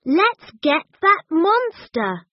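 high, lively, stress on that; eager. A narrator's reading. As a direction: urge everyone after the monster. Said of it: "said Chip".

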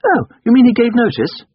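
put-on high, loud questioning boy's voice; young master. A narrator's reading. Boy questioning the coachman in the garden, high childish query. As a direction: ask as a small boy, pitched high.

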